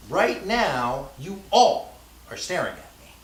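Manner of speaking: "all" punched loud; stress on ALL. Shown as a demonstration. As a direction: set "all" apart and hit it, then fall away. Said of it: "placed the emphasis on a different word".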